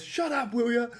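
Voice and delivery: high-pitched mock "Shut up", retelling the outburst; imitating a snap. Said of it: "he goes".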